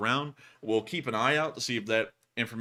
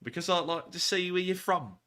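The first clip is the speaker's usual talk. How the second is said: playful put-on British accent; lilting mock-British voice; 4 semitones higher than usual. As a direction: ask it like a chatty Brit. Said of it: "saying that in a British accent".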